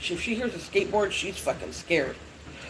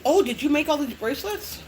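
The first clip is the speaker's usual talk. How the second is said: higher imitation of an asking customer; curious customers; 7 semitones higher than usual. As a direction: mimic customers' questions, pitched up. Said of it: "everybody always asks me".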